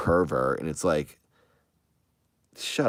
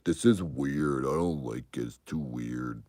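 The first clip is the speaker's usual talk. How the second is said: dismissive viewers. Retold or imitated. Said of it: "were like".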